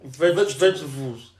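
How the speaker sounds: slow false start, "vegetables" slurred as vezh-vezh-uh-vooz; struggling pronunciation. Real, not acted. Podcaster told to say it fast, fumbling "vegetables" slowly as vezh-uh-vooz. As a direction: stumble through "vegetables", its ending turning to "vooz". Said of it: "I've never been able to say this word".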